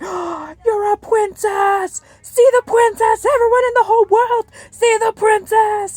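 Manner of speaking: high, loud, thrilled squeal; excited little friend. Retold or imitated. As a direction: imitate an awestruck kid announcing royalty at full volume.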